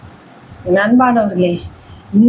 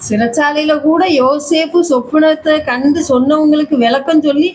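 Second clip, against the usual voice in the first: normal talking voice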